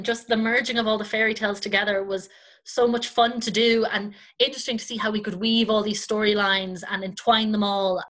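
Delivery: usual speaking voice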